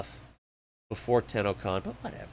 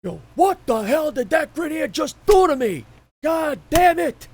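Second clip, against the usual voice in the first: loud, high Brooklyn shout; Brooklyn accent; 8 dB louder than usual. Shown as a demonstration. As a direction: yell it like a Brooklyn tough guy. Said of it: "I can talk like I'm from Brooklyn".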